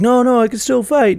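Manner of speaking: put-on high, pleading kid voice; a young hero begging to stay in the fight; eager kid protest. Kid Spider-Man protesting to Tony.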